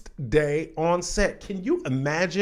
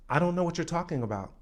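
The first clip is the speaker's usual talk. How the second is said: playing it cool; 3 semitones higher, 4 dB quieter, much faster than usual. Actress brushing off the star's apology, feigned cool. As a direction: act out feigned nonchalance, light and fast. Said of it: "Don't let them see you sweat".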